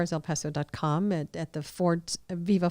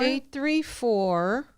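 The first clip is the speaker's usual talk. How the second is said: very slow, drawn-out digits, higher pitch; slow, clear repeat; 4 semitones higher, much slower than usual. Shown as a demonstration. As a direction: stretch each digit out for listeners. Repeating number said too fast.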